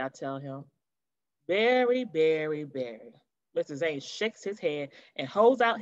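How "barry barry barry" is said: stern teacher calling the name, fading lower each time; tut-tut the boy's name, dying away; scolding teacher. Acted.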